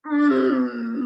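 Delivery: loud, drawn-out mouth-full "mm"; mumbled groan. A narrator's reading. Earl struggling to swallow a mouthful, loud mumbled groan. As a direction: groan through a mouthful of food. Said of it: "mumbled the Earl".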